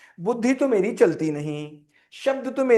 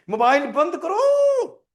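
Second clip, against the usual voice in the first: higher, louder, slow yelling command; angry parent shout; 8 semitones higher, 4 dB louder, much slower than usual. Shown as a demonstration. Parent yelling at child to switch off the phone, loud slow shout. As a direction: bark it at the child like a furious parent. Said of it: "tone में।".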